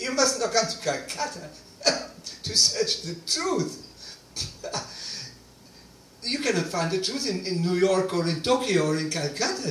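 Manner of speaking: high, amused, laughing through the words; laughing Mother Teresa. Retold or imitated. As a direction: retell her laughing at the naive visitor. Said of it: "She started laughing like she had never had".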